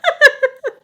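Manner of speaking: loud, high burst of laughter. Real, not acted. Storyteller cracking up at the mom's reaction, laughing.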